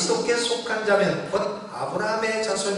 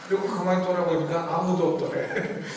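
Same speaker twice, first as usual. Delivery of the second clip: ordinary delivery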